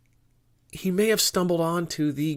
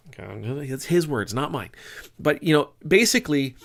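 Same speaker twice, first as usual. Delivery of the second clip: normal talking voice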